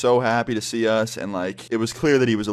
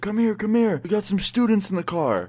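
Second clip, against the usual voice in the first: high, eager call from the car; 10 semitones higher than usual. Retold or imitated. Friend yelling from the car window to a passerby, high excited call. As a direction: beckon him over with high excitement.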